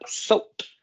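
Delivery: "saute" said as one syllable, final T sounded, E silent; one syllable word. Shown as a demonstration. Teacher to first-graders: "saute" as one syllable, T sounded. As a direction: say "saute" in one beat with a clear T at the end. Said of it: "c'est juste un mot à une syllabe".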